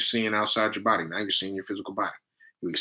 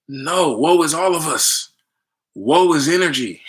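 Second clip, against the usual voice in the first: louder and higher, slow and preachy on woe; emphatic outburst; 6 semitones higher, 7 dB louder than usual. Real, not acted. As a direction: declare it to the room, leaning on every woe. Passionately correcting the woe idea.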